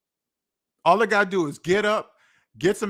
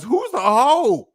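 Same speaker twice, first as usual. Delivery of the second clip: high, loud, drawn-out disbelief; stretched, raised-voice challenge; incredulous challenge; 6 semitones higher, 5 dB louder, much slower than usual. Acted. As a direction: throw it out as an outraged question. Incredulous at men calling women hoes.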